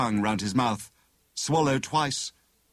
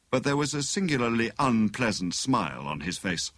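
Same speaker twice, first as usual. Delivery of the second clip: plain reading voice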